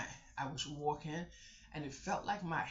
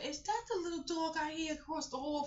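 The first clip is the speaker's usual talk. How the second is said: sweet high voice; 13 semitones higher than usual. Retold or imitated. Sweet talk to dog owner.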